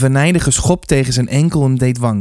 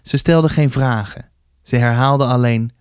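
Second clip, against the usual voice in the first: ordinary delivery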